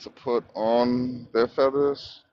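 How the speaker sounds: slow, low read-out; reading aloud. Real, not acted. Guest reading the debate prompt aloud, slowly.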